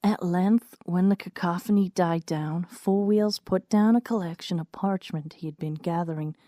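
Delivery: plain reading voice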